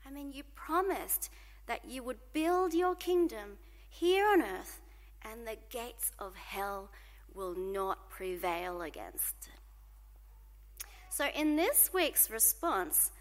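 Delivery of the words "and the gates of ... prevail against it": hushed. Acted. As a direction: drop to a slow whisper. Dramatic drop on promise.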